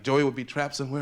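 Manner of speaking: ordinary talk, nothing marked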